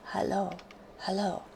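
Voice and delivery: ordinary talk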